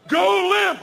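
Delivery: shouted high and loud; panicked shouted command; urgent yell. Retold or imitated. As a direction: bark the order in a panic. Trainer yells instruction in panic.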